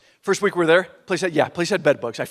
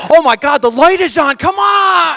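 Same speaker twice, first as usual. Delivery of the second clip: much higher and loud, shouting; frantic shout; 10 semitones higher, 10 dB louder, slower than usual. Retold or imitated. Husband seeing the warning light, frantic shouting. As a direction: yell in full panic at the light.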